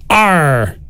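gruff pirate "Arr"; pirate growl. Shown as a demonstration. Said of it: "here's the phrase in your best pirate voice".

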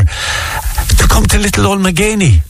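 ordinary talk, nothing marked

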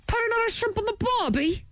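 high, quick fake Australian accent; badly faked Australian accent. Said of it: "Yeah, I'm trying".

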